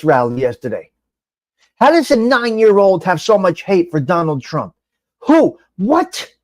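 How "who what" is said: high, disbelieving Who? What?; pitched-up disbelief; incredulous. Real, not acted. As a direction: sound stunned at the news. Host reacting to child's hate.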